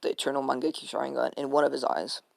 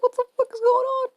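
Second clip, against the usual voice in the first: much higher and louder, startled outburst; mock shock; 6 dB louder than usual. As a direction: squeal it like he can't believe it. Itachi baffled by the hug.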